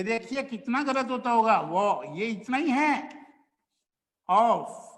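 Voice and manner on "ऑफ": ordinary talk, nothing marked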